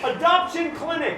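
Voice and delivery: high, loud call-out; shout. Real, not acted. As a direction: yell the suggestion. Shouted suggestion.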